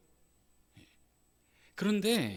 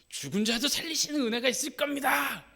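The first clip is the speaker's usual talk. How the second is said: higher, bold preacher's cry; imagined proclamation; 10 semitones higher, 4 dB louder than usual. Pretend. Pastor imagines boldly declaring to the congregation, high and loud. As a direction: proclaim it as if fully confident. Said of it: "하고 외치고 싶은데".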